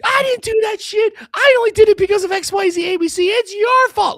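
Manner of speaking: very high, loud mock shouting back; defensive husband yelling. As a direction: ham up the clueless husband yelling. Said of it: "The worst thing you could do at that point is to be like".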